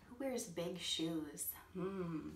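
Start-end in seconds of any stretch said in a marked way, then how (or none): none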